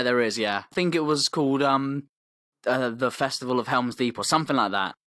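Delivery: normal talking voice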